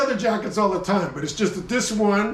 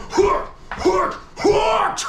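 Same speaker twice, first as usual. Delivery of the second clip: Spartan war grunts; 13 semitones higher, much slower than usual. Pretend. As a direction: bark like a soldier ready for battle. Schott jacket ready for battle.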